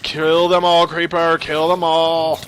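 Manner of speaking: loud, high battle cry; frantic urging. Real, not acted. Player urging the creeper to attack mobs, frantic yelling. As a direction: holler it, stretching the words.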